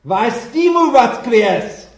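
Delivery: roaring Russian Easter response; crowd's loud reply. Retold or imitated. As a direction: answer back like a whole crowd in unison. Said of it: "from the crowd came back".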